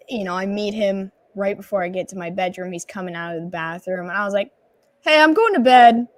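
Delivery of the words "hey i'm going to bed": high, loud retold greeting; over-loud announcement. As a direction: blurt it out loudly, acting it out. Acting out drunk greeting.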